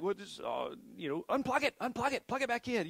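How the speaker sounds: helpless pleading, pitch way up; exasperated. Retold or imitated. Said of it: "So we're like".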